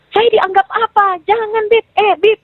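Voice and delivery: high-pitched, indignant challenge; retold angry protest. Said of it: "Ngamuk, saya nggak tahu kenapa masuk jin". (menirukan protes marah bernada tinggi)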